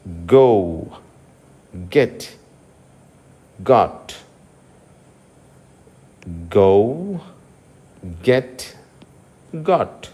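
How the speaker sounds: గో said low with a firm g; hard g. Shown as a demonstration. Teacher models g words low and firm. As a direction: press the g in గెట్. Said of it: "జీని ఏమని పలకాలి".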